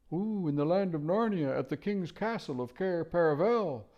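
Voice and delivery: high hooting owl voice. Acted. Owl telling the children where they are, hooting high.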